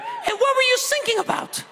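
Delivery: put-on very high little kid voice; imitated child, squeaky high pitch; son in back seat. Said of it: "from the back seat, I heard".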